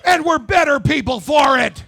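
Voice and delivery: loud rally shout to the room; shouting out to the crowd, fired up; calling to the crowd. Real, not acted. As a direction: whip up the audience, shouting.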